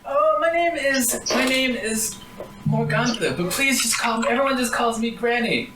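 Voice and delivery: lightly voiced old granny, warm and eager; a hint of a quavering old woman. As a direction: offer the pies like a proud grandma.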